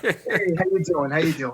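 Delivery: light New York greeting; a friendly New York hello; New York accent. Pretend. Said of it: "give me a".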